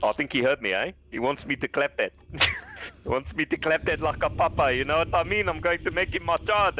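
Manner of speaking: hammed-up South African bravado; South African accent. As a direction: play a cocky South African egging someone on. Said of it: "But then the South African came out".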